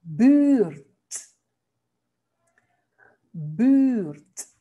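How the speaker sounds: 'Buurt' with a long, drawn-out uu, like ü; 'Buurt.' said loud and isolated, firm B, long ü; Dutch pronunciation demo. Shown as a demonstration. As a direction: model 'Buurt' slowly, stretching the rounded vowel. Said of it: "B, no buurt".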